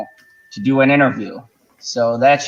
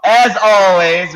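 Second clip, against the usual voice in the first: announcer build-up; 6 semitones higher, 6 dB louder, much slower than usual. Acted. Signature sign-off intro.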